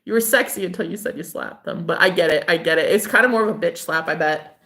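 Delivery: usual speaking voice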